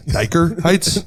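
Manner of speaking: Dyker Heights with the R dropped, hammed up; mock Brooklyn Dyker Heights, no R. Mocking his dad's Brooklyn Dyker Heights with no R. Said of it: "you never talk like that".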